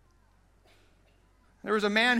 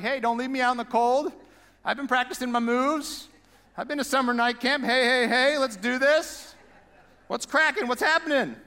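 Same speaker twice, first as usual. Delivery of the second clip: put-on higher, eager voice of a left-out older son; whiny older son; 5 semitones higher than usual. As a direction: play him as a pushy kid begging to join the party. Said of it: "He's like".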